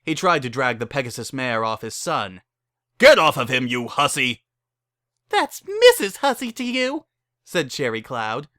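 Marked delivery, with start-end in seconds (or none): 3.0-4.4 loud angry shout; furious father. Acted. The father yells at the bride kissing his son. 5.3-7.0 much higher, loud and cheeky; sassy retort. Acted. The bride snaps back at her new father-in-law, sassy.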